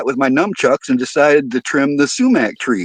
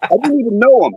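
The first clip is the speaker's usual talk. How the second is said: normal talking voice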